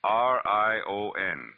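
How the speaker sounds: slow, careful spelling of the number; spelling it out slowly. Shown as a demonstration. Detective spelling out the phone number for Shelley. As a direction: lay out each letter and digit slowly. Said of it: "You get it?".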